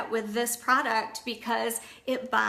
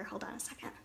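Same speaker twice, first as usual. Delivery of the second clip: whispering; 14 dB quieter, faster than usual. Real, not acted. Aside while fetching something.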